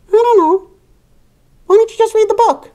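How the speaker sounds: very high, fast squeaky puppet frog; Toad puppet voice. Acted. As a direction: play the cheeky little toad friend. Puppet Toad answers the reader.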